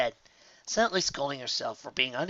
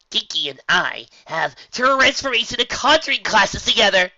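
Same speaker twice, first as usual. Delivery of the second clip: loud, high and eager; excited announcement; 9 semitones higher, 10 dB louder than usual. Acted. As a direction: boast to the breakfast table. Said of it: "Guardian announced ... excitedly".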